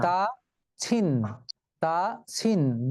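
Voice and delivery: তা ছিন said as two separate beats, তা then ছিন; teacher slowly splitting the name তাসিন into two syllables; syllables split. Shown as a demonstration. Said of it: "দুটি অক্ষর।".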